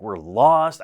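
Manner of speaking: a loud, exasperated burst; upset outburst. Retold or imitated. Pastor retelling his panic to his son, loud mock outburst. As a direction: blurt it out like a lost, winded hiker. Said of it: "I started to get really, uh, upset".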